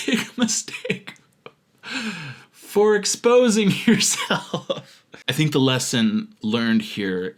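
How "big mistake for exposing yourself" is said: high, slow words squeezed through laughter; helpless laugh running through the words. Real, not acted. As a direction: crack up while reading aloud. Cracks up reading the repeated accusation.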